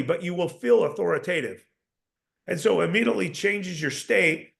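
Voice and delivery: ordinary talk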